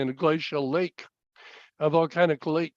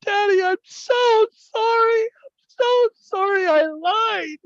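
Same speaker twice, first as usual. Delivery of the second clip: put-on loud, very high wailing little boy; hammed-up high-pitched tearful wail; bursting into tears; 5 dB louder, slower than usual. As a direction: imitate a child sobbing out a confession, drawn out. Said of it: "he burst into tears".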